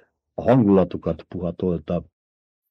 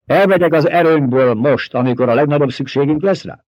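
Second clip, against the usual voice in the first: loud, higher, commanding ducal voice; Paul proclaiming; 5 semitones higher, 3 dB louder than usual. A narrator's reading. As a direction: declare it like a lord before his people. Paul's speech continues. (ünnepélyes, parancsoló hercegi hang)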